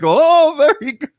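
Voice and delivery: high, loud "oh" breaking into laughter; mock amazement, laughing at the end; impressed exclamation. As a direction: imitate a delighted host, swooping way up. Imitating the delighted host's reaction.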